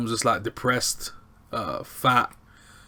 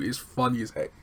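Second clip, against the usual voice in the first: ordinary delivery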